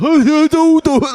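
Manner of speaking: high, squealing mock laugh; imitating her giggle; mock Olivia Chow laugh. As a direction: cackle like her hammy laugh. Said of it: "She's like".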